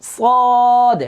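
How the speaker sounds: সদ held long and high, a full sustained vowel; correct recitation. Shown as a demonstration. Teacher modeling the right sound for students. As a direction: sing out সদ slowly like Quran recitation. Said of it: "আমার মুখে খেয়াল করেন".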